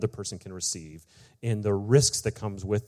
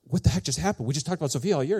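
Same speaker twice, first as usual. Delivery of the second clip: higher-pitched retold disbelief; baffled confrontation; 6 semitones higher, faster than usual. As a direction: demand answers, mock bewildered. Dad confronts son after mix-up.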